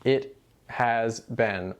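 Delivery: slow, deliberate It has been; slow teacher demo; slow example. Shown as a demonstration. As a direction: spell It has been out word by word. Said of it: "in the same way that we say in English".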